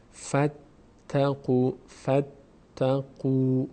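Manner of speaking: فاتقوا with ف joined to ت, slow; slow recitation demo. Shown as a demonstration. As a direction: glide فاتقوا slowly, ت doubled. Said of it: "ف میچسبه به ت".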